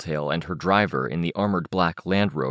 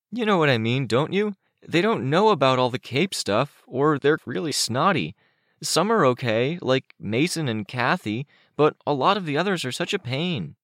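put-on higher, boyish character voice; complaining Chicken Little; 8 semitones higher than usual. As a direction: grumble like a kid about other kids.

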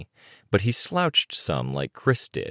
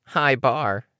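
higher-pitched quick comeback; retort; 8 semitones higher, 3 dB louder than usual. A narrator's reading. Imp answering a teammate's remark, higher-pitched retort. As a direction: toss it back in a raised voice. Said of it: "Imp retorted".

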